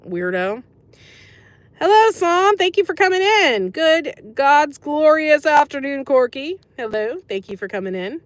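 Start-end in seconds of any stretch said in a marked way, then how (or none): 1.8-3.7 much higher and louder, a sing-song welcome; over-the-top greeting. Pretend. Greeting a chatter who joined. 3.7-6.6 hammed-up greeting. Greeting another chatter.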